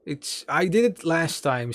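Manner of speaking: normal talking voice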